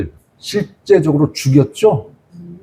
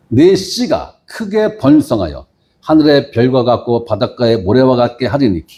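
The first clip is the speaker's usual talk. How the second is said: measured, lower Bible reading; scripture reading; 4 semitones lower than usual. Acted. Pastor reads Genesis to the congregation, solemn reading. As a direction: read the verses with solemn weight.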